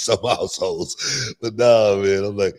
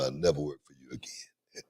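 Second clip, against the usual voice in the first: hushed retelling; 7 semitones lower, 9 dB quieter than usual. Retold or imitated. Retold quitting on the promoter.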